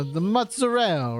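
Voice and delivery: MOZZARELLA as "mutza-rell", final vowel dropped; mock New York Italian mozzarella, stretched "rell"; Italian-American accent. Shown as a demonstration. Pizza reviewer joking in an Italian-American accent. Italianized retake after gabagool joke.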